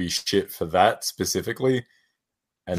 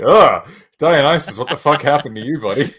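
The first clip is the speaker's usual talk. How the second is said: loud, pained groan at a friend; disbelieving "what happened to you"; exasperated dismay; 7 semitones higher, 6 dB louder than usual. Retold or imitated. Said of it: "I'm like".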